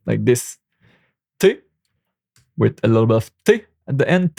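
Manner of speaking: ordinary delivery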